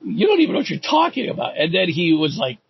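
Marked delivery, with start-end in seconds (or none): 0.0-1.6 much higher disbelieving retort; a high, exasperated put-down; incredulous retort. Retold or imitated. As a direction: throw it back at him in disbelief. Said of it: "is like".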